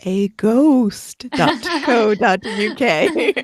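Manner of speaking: slow, drawn-out ghostly voice; spooky voice. Pretend. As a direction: say it like a ghost haunting. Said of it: "I had to say that in a spooky voice".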